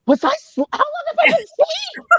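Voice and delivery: very high, panicky mock squeak; stumble, then shoot up in alarm; imitating shock on waking; startled realization. Acts out waking on the phone.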